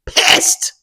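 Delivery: unvoiced, hissed burst of outrage; mock fuming. Reader sums up her feelings on e-book-only novellas, fuming hiss. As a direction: hiss the word out hard.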